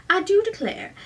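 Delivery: high-pitched Southern belle; best Southern drawl. A narrator's reading. Girl quoting a favorite movie, showy Southern drawl. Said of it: "in her best drawl".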